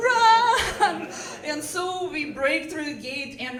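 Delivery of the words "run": high, loud, drawn-out cry to flee; hammed-up escape call; urgent shout. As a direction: yell it as a comic alarm. Urging strangers to flee, escalating.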